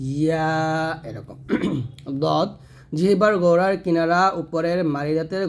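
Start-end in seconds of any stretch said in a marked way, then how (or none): 0.1-0.5 ইয়া gently drawn out; soft letter demo. Shown as a demonstration. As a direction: let the sound of ইয়া flow on softly. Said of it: "আর ইয়াটা নরমভাবে". (নরমভাবে টানা ইয়া)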